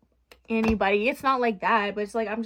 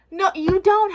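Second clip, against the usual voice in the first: higher and louder, punching DON'T; emphatic no; 8 semitones higher, 9 dB louder, slower than usual. Real, not acted. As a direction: yell it at your past self. Scolding her past self.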